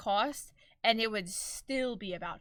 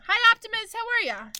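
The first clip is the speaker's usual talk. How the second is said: loud, very high hello; excited greeting; 12 semitones higher, 10 dB louder, faster than usual. Real, not acted. Greeting a viewer who just arrived, high and loud. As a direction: sing out a high, loud welcome.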